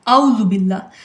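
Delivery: आऊज़ु said with a long open आ at the start; आऊज़ु spoken with a stretched long आ; wrong way to say it. Shown as a demonstration. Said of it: "आऊज़ु बिल्लाह नहीं है".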